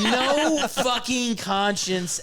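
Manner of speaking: slow, high, laughing through each word; can't stop laughing at him; laughing disbelief. Real, not acted. Mocking the heartless player to friends, slow and laughing.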